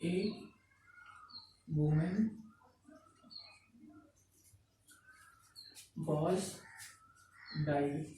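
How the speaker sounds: isolated soft words, long gaps between; word-by-word dictation. Real, not acted. Teacher writing an example sentence for the class, slow word-by-word dictation. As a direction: dictate haltingly, at writing speed.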